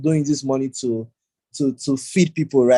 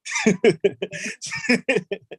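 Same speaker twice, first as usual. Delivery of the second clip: laughing; 8 semitones higher than usual. Real, not acted. Host laughs at own joke.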